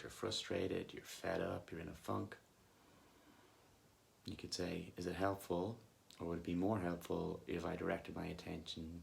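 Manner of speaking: soft, unhurried meditation voice with long pauses; guided meditation. Acted. Coach leading listeners back to body and breath, calm guided meditation. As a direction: gently guide them back to the breath, leaving long silences.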